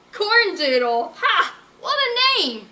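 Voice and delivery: high, loud mocking scoff; mocking laugh. Berkeley mocking Sadie's ex's name, high scoffing laugh.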